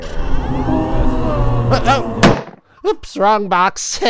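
loud startled yelp, high pitched. Acted. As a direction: jump back in surprise. Oops wrong box.

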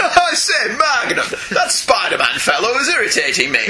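high-starting, put-on gentleman villain; hammed-up old-chap annoyance; mock upper-crust British. Villain complaining to Magnum about Spider-Man, mock upper-crust British. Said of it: "kind of voice, can't you?".

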